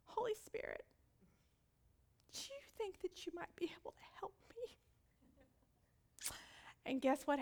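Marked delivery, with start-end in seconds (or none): 0.0-4.8 higher, soft, unvoiced humble request; timid whispered plea. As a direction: ask sheepishly under your breath. Humbled sister asking for help.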